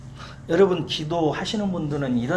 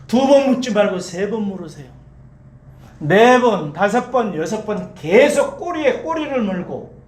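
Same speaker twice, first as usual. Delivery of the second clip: loud, raised, emphatic preaching; building exhortation; 3 semitones higher, 4 dB louder than usual. Real, not acted. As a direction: drive each repeated phrase home. Preacher builds exhortation to keep asking.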